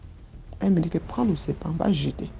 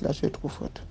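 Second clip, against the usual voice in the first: voiceless, much softer accusation; hushed accusation; 9 dB quieter than usual. Retold or imitated. The nanny whispering an accusation at the girl. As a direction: whisper it like a dark secret. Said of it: "Elle me dit".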